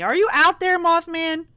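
high, loud call into the dark. Real, not acted. Player calling out to the hidden creature, loud and high. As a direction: shout to something you can't see.

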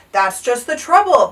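loud, exasperated storybook king; bellowing. A narrator's reading. King answering his page boy, bellowing. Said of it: "bellowed the king".

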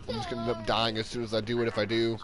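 ordinary talk, nothing marked

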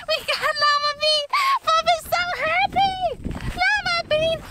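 high, excited pet talk; overjoyed cooing. Real, not acted. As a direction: gush to the pet you just found. Rejoicing at finding pet, cooing to it.

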